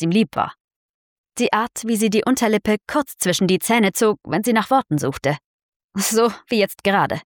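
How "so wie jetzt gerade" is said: ordinary delivery, nothing marked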